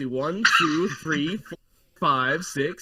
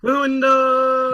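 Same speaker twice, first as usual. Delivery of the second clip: loud, high, stretched-out call; high sustained yell; drawn-out shout; 8 semitones higher, 7 dB louder, slower than usual. Pretend. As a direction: holler it out long. Player calls out the word loudly.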